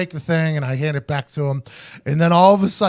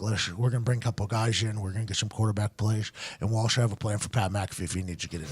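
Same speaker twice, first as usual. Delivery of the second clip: low, quiet coach mumble; understated press-conference murmur; imitated coach; 9 semitones lower, 10 dB quieter than usual. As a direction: drop low and soft like a subdued coach.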